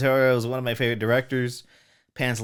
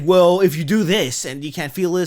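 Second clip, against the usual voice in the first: raised, louder mock-earnest line; acted movie quote; 4 semitones higher, 7 dB louder than usual. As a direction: quote him with a touch of drama. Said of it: "he's like".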